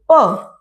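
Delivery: isolated 'pa' for P; phonic P sound. Shown as a demonstration. Teacher answering her drill question, isolated 'pa'. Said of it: "क्या बोलोगे?".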